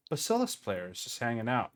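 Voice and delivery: usual speaking voice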